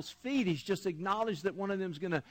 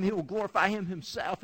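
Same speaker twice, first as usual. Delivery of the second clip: ordinary delivery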